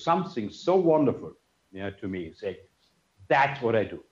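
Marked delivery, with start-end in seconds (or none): none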